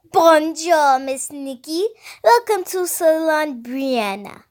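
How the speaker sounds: child's hammed-up French accent; awful fake French accent. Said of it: "in an awful fake French accent".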